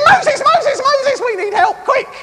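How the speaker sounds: very high, frantic mock squeal; panicked God. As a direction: cry out for help in a hammed-up panic. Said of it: "He didn't say".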